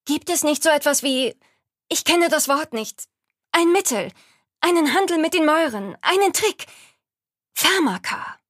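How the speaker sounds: high, loud, urgent begging; desperate pleading. Acted. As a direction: beg for any way out.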